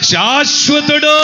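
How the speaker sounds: very slow, loud proclamation; preacher crying out the eternal one; drawn-out shout. Acted. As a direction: stretch the word out in praise.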